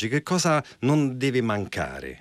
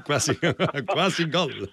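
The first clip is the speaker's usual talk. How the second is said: higher, amused echo through laughter; chuckling, delighted repeat; laughing; 5 semitones higher than usual. Real, not acted. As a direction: laugh while repeating the catchphrase. Host laughs repeating famous catchphrase.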